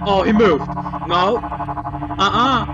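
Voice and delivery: loud startled cry of fear; scared yell. Real, not acted. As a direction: jump out of your skin yelling. Animatronic moves, he yells.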